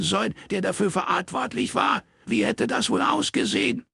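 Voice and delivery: put-on high, furious old man's shout. Red-faced father yelling at his daughter. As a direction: yell it at your daughter across the table. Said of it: "schrie er".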